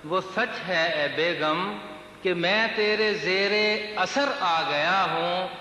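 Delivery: measured mushaira declamation, words drawn out; lilting Urdu verse delivery; poetry recitation. Acted. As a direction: recite the couplet to a listening crowd.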